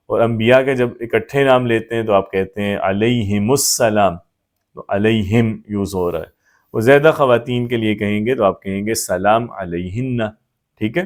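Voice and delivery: usual speaking voice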